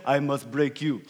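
low, soft mock Russian villain line; a hint of a thick Russian accent, quoted with a laugh; lightly done Russian accent of Ivan Drago. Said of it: "quoted Ivan Drago in his thick Russian accent".

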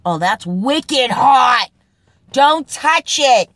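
put-on a Boston guy warning someone off a hot dish, in a loud Boston accent. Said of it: "I'd say Wicked Pissa".

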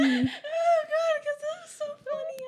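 high tearful wail of amusement; crying with laughter. Real, not acted. Friend explaining the tears, crying laughing. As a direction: wail it through real laughter. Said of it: "[name]'s crying".

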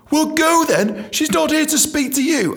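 high, scornful imitation of a boss; hammed-up high put-down; mock sharp dismissal. Boss dismisses him, acted.

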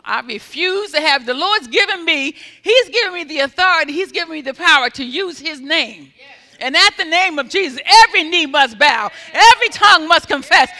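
building to loud, high declarations; fiery preaching. Real, not acted. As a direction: proclaim authority over pain, swelling to a shout. Preacher building to full force.

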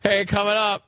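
high-pitched imitation of a promo; show intro. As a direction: loose parody of a show tease. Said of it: "It's usually like".

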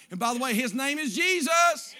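shouting climax of the sermon; triumphant shout. Acted. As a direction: proclaim Jesus at the top.

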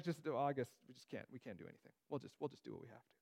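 trailing off into sarcastic defeat; mock sarcastic. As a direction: imitate a sarcastic shrug of giving up. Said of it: "he says it so sarcastic".

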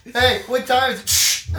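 loud, high, drawn-out question; hammed-up desperate calling. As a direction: shout it across a noisy club. Asking stripper across noisy club.

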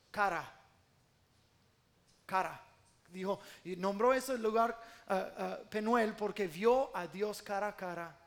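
usual speaking voice

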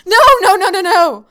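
high, loud, indignant protest; mock outrage. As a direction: play up the betrayal, loud and high.

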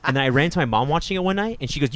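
ordinary talk

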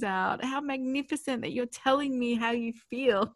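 delighted praise. Acted. Imagined response to a child's tantrum, gushing with delight. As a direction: marvel out loud at their big feelings.

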